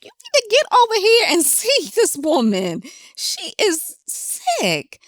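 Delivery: high, exasperated retold scolding; angry outburst. Telling off a family member who wouldn't visit, angry. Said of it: "And I was angry".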